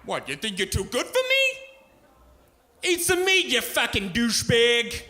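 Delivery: high, loud mock mobster pitchman; Sopranos tough guy. Imagined Tony Roma's ad guy yelling at the camera, hammed up. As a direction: ham up a loudmouth New York restaurant ad. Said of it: "Sopranos kind of guy".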